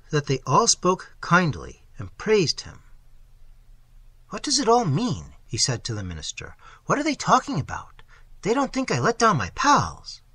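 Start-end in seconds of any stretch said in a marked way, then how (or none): 4.3-5.3 much higher, puzzled question; bewildered. Acted. Wounded soldier asks the minister, high and bewildered. 6.8-10.2 much higher, puzzled question; bewildered. Acted. Wounded soldier asks the minister, high and bewildered.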